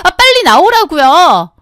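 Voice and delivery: high, slow, whining sister-in-law; whiny demand. Acted. Sister-in-law whining to be picked up, demanding. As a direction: drag it out like a spoiled brat.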